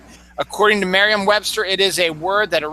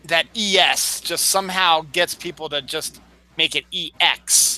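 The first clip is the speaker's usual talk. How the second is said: normal talking voice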